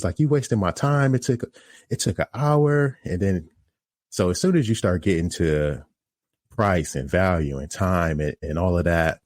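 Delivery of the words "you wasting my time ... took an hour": high-pitched mock complaint; imitating customer's scene. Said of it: "causing this scene over it".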